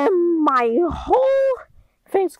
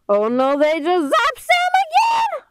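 alarmed shout; 5 semitones higher, slower than usual. Acted. Friend zapped by villains.

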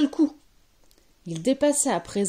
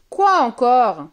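loud, higher exasperated outburst; fed-up witch; irritated witch; 4 semitones higher, 10 dB louder, slower than usual. A narrator's reading. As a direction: burst out in annoyance at being disturbed. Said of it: "s'énerva Croquella".

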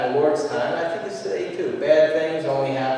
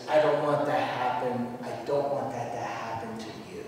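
soft, low, earnest concern; hushed, caring caution; tender warning; 4 semitones lower, 7 dB quieter than usual. Acted. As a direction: lower the voice and plead gently. Acting Jesus' tender warning.

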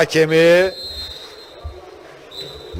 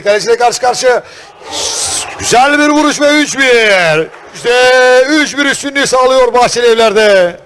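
loud, high goal commentary; excited goal call; 5 semitones higher, 7 dB louder than usual. Real, not acted. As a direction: shout the third goal.

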